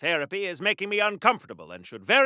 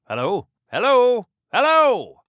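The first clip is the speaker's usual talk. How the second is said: calling out; 3 semitones higher, 7 dB louder than usual. Acted. Calling out, no one answers.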